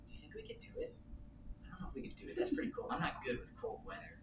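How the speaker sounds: high, hushed and doubtful; nervous whisper. Retold or imitated. Couple hesitating at the freezing cove, nervous whisper. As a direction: whisper it like you're scared to try. Nervously asking wife about cold swim.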